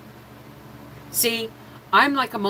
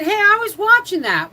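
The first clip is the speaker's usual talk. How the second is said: put-on much higher, loud protest like a wronged child; kid's indignant yell; 8 dB louder than usual. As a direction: shout it at the brothers who stole the channel. Retold quarrel with brothers over TV.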